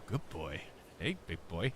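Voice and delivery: low, hushed creepy voice; a hint of a creep leaning in. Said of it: "he even says it all creepy".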